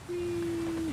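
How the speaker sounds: high playful whee; gleeful whee. Acted. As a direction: squeal with glee. Jumping off a cliff.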